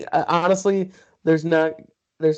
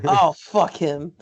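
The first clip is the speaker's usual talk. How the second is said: dismissive; 2 semitones higher, 6 dB louder, much slower than usual. Retold or imitated. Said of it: "And he goes".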